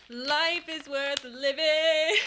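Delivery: drawn out very slowly, grandly; mock-dramatic declaration. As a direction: proclaim it like a grand epiphany. Mock-dramatic joy over gifts.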